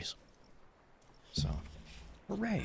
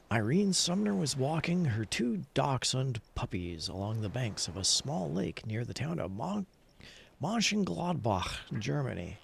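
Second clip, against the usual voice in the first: lively storyteller reading a news anecdote aloud; story reading; 4 semitones higher than usual. Acted. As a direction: read it like a fun tale for the chat. Reads another fishing story.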